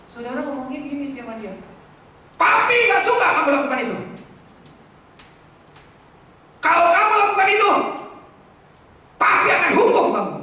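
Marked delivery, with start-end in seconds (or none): 2.3-10.3 Papi punched high and loud, fast angry scold; stress on Papi. Retold or imitated. Angry father scolding his child, stressing Papi. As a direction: hit the word Papi hard, scolding fast. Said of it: "Papinya yang ditekankan".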